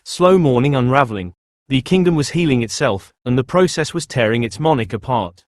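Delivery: usual speaking voice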